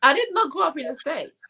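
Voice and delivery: high-pitched, insistent denial; emphatic correction. Real, not acted. Guest correcting the host about the States, emphatic correction. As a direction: set the record straight, pitched well up. Indignant correction of the host's mistake.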